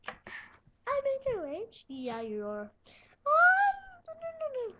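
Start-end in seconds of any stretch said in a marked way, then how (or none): 0.1-1.9 amazed disbelief. Acted. Disbelief at winning. 3.3-4.7 high, loud squeal of happy disbelief; overjoyed squeal. Acted.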